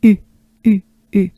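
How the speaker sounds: short, isolated French U; French u demo. Shown as a demonstration. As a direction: model the French U in separate little bursts. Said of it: "French sound to pronounce".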